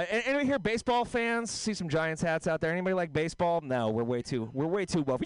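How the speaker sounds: ordinary delivery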